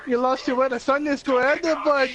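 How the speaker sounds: put-on a light Irish accent on the squirrel; hint of a thick Irish brogue; mock Irish accent. Retelling the squirrel line in a mild Irish accent. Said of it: "in that thick Irish accent".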